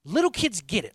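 loud, high, fervent preaching; rising sermon fervor; preaching at full force. Acted. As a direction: proclaim it to the back row.

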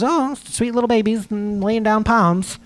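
mocking naive view. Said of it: "We all just think it was".

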